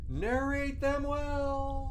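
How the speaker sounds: slow, soft solemn blessing; solemn farewell. Acted. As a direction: pass on the torch gently. Farewell of the old narrator.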